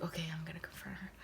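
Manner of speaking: fast, hushed whisper, bracing herself; nervous whisper. Retold or imitated. Whispering to herself at Denny's before confronting Letty. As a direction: rush it under your breath.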